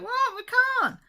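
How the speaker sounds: very high, loud shocked cry; hammed-up horrified protest; mock outrage at lockdown news; shocked disbelief. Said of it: "We're like".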